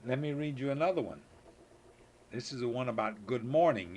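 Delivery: ordinary delivery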